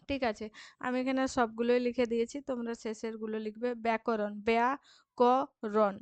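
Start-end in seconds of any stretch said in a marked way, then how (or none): 3.9-5.9 ব্যাকরণ slowly as ব্যা, ক, রণ; split into syllables. Shown as a demonstration. Teacher isolating the last syllable, word slowly split. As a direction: space out each syllable of ব্যাকরণ. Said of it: "শেষ দলগুলির মধ্যে".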